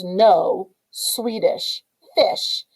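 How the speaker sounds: very slow and deliberate; drawn out slowly. Shown as a demonstration. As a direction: stretch each word out. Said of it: "if you take the words".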